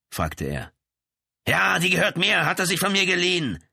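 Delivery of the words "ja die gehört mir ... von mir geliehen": high, loud, aggressive answer; heated admission. A narrator's reading. Brother admits the gun is his, aggressively. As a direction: fire it back heatedly.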